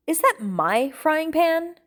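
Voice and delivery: high, loud, drawn-out question; slow incredulous outburst; indignant disbelief. Acted. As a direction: demand it, appalled, voice shooting up. Outraged on noticing his own pan.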